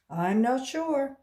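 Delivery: wary man. A narrator's reading. Man reports to colleague.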